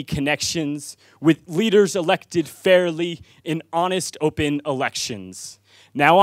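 high, driving rhythmic verse; spoken-word poem. Real, not acted. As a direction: proclaim it to the crowd, dropping at the close of phrases. Poem builds again.

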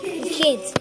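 ordinary delivery

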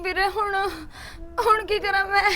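speaking through tears; frightened mother, near sobbing; crying. Acted. Sister telling her brother about the call, crying.

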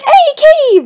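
squealing at the sight of a cave; excited shout. Retold or imitated. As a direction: yell it like you just spotted treasure. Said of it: "I was like".

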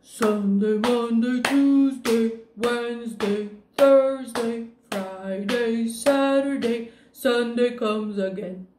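lightly sung monster voice. Shown as a demonstration. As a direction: sing it like a playful monster. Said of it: "How about we sing now in a monster voice?".

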